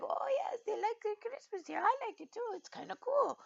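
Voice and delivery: very high, sweet put-on voice; squeaky, approving character voice; happy newcomer. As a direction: imitate a friendly neighbour in falsetto.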